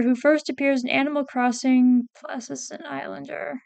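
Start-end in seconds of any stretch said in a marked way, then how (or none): none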